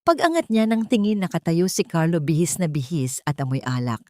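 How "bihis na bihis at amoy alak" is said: ordinary delivery, nothing marked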